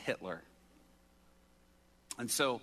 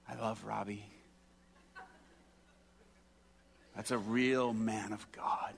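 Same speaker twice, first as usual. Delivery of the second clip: warm, moved. Pretend. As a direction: play deeply touched, trailing into a whisper. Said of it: "This warm feeling comes across him".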